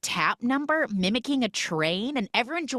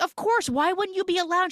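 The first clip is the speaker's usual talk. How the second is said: acted reassurance; 13 semitones higher, 4 dB louder than usual. Retelling an acted cat dialogue.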